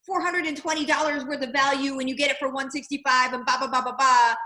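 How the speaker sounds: high, eager salesy voice; pushy sales pitch. Retold or imitated. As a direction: oversell it like a pushy rep. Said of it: "we shouldn't be saying".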